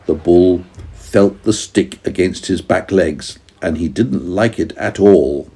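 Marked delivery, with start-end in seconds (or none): none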